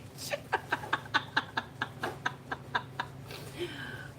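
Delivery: soft breathless giggling; airy giggle. Real, not acted. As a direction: giggle quietly to yourself. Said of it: "I needed that giggle".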